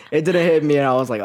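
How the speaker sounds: ordinary delivery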